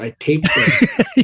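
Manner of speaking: laughing. Real, not acted. Joking confession, laughing.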